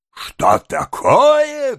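high, drawn-out exclamation; outraged cry. A narrator's reading. Beadle bursting in on the kissing couple, outraged cry. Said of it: "вскричал мистер Бамбл".

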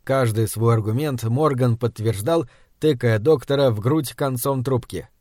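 ordinary delivery

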